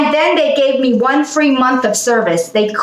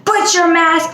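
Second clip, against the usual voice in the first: higher mock yell of a store clerk; retold scream; 7 semitones higher than usual. Clerks yelling at unmasked customer, mock shout. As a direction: bark the order like a scared clerk. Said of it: "they were screaming at me".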